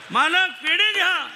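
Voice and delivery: high, loud cheerful call; cheerful offering. Retold or imitated. Servant gleefully offers sweets to the master. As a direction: announce it like good news.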